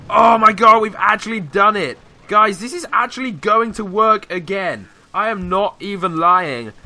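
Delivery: thrilled shout of disbelief; real elated outburst; excited. Real, not acted. Attack finally succeeds, elated.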